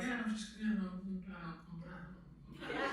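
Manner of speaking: sheepish hedging. Retold or imitated. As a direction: trail off like an embarrassed actor. Acting out sheepish actors' answer.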